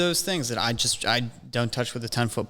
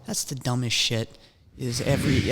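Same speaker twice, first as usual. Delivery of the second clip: usual speaking voice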